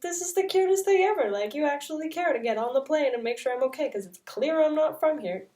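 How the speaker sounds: excited, high-pitched rush of appreciation; touched gushing. Retold or imitated. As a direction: gush over a sweet kindness. Gushing quote of own touched reaction.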